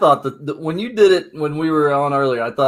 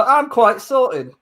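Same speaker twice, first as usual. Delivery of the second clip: high, drawn-out mock Cockney accent; put-on Cockney; 7 semitones higher, much slower than usual. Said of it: "you'll have the Cagney down".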